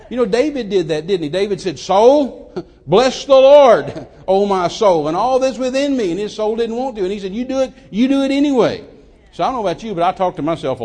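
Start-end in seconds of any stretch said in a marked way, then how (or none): 1.8-6.1 loud, high, drawn-out command; commanding proclamation. Retold or imitated. Preacher as David ordering his own soul, loud commanding cry. 7.3-8.9 loud, high, insistent order; insistent command. Retold or imitated. Preacher as David overruling his unwilling soul, loud insistent command.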